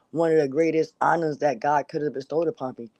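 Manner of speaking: usual speaking voice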